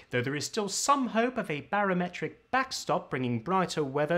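upper-class English newsreader, resonance pulled back into a tight throat; upper-class English accent. Shown as a demonstration. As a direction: read the weather like a BBC presenter in a clenched upper-class English accent. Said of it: "Let me show you what I'm talking about".

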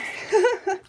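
short high laugh; quick real laugh; high giggle. Real, not acted. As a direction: giggle at your own pun. Laughing at own pun.

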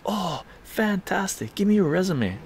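retold mentor seizing the chance; enthusiastic reaction. Mentor reacts keenly to his news.

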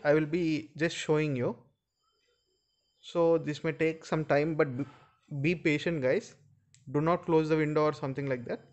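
normal talking voice

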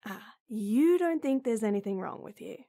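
ordinary talk

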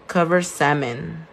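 usual speaking voice

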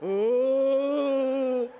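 loud, drawn-out spooky "Ooh"; spooky ghost moan. Pretend. Preacher spooking his disciples in the boat with a loud ghostly ooh. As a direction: play it up like a ghost scaring friends. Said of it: "I'd be like".

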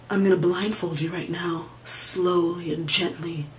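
low, soft, seductive murmur, partly whispered; sensual hush. Pretend. Porn fantasy voiced as a lover's soft low whisper. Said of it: "make it more sensual".

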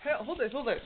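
normal talking voice

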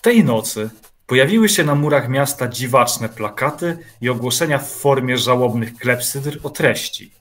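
ordinary talk, nothing marked

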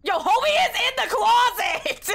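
high loud panicked cry; panicked shout. Real, not acted. Discovers someone in closet.

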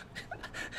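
a short unvoiced laugh; breathy laugh. Real, not acted. Laughing at how he got away with it. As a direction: let out a quiet airy laugh. Laughing at his own story.

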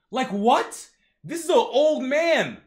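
high, loud disbelief; incredulous outburst. Real, not acted. Reader reacts to old man being shot at, loud disbelief. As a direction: protest it in real outraged amazement.

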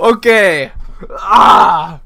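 high, loud yelp of fright; frightened cry. Real, not acted. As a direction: cry out still shaken. Still reacting to scare.

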